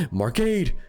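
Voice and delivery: high, loud desperate call; calling out a name. Acted. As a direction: shout his name across the room. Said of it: "someone calling out my name".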